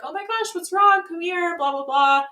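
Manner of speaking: high-pitched, gushing sympathy; imitating sweet concern. Date opening the door to crying woman, mock sweet concern. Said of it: "Connor so sweetly opens the door".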